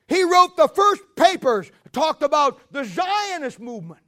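loud, high, driving sermon voice; full-force preaching. Real, not acted. Preacher hammering history at his congregation, loud and high. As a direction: preach it hard to the pews.

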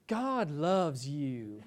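very slow, soft, syrupy preacher voice; drawn-out soothing televangelist; Joel Osteen impression. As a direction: croon it slowly like a TV prosperity preacher. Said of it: "I'll try to do my impersonation".

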